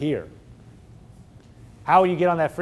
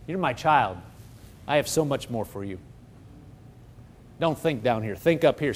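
softer, lower, tender reassurance; gentle voice of God; 4 semitones lower, 6 dB quieter than usual. Acted. Preacher voicing God to his child, tender and soft. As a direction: speak low and kind like a loving father.

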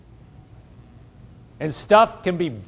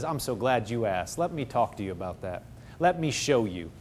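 much softer and lower, tender; gentle God; 6 semitones lower, 9 dB quieter than usual. Acted. God answering someone who asked, soft and tender. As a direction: speak kindly and quietly like a patient teacher. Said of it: "He comes and says".

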